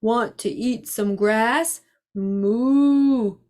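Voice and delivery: slow, drawn-out cow character. A narrator's reading. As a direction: stretch the words like mooing. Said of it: "said the cow".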